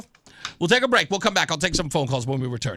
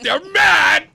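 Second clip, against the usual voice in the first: much higher and louder, shouted in triumph; gleeful shout; 13 semitones higher, 10 dB louder than usual. Real, not acted. Host shouting to Rosette that listeners are mad, gleeful shout. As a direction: yell it out in delight. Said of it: "I get excited".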